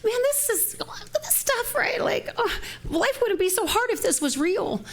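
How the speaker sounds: very high, whiny voice of self-doubt; doubting inner voice. Retold or imitated. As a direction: whine like your own skeptical mind. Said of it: "I get confused sometimes and go".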